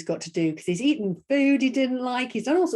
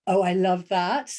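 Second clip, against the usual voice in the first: ordinary talk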